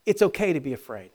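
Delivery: usual speaking voice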